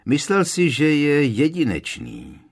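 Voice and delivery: explaining. Acted. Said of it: "vysvětloval Harry".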